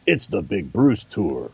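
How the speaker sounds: slow announcer drawl; lightly voiced hype announcer; Andy Frost voice. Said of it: "got the Andy Frost voice".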